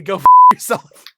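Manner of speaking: sudden high, very loud blurt; mock outraged shout. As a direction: burst out shrieking in playful outrage. Mock outburst over owing money.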